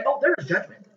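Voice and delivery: lightly voiced daughter, high; a hint of the daughter's voice; quoting daughter. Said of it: "what did my daughter say".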